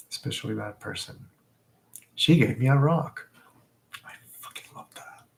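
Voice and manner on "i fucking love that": fast, hushed whisper; whispered aside. Real, not acted. About the friend's gift of a rock, quick whisper. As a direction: murmur it as a secret aside.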